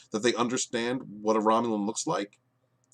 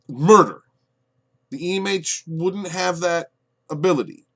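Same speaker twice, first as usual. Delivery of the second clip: "Murder" blurted much louder; outraged exclamation; 8 semitones higher, 9 dB louder than usual. Real, not acted. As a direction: spit the word out in disgust. Outraged at the plot.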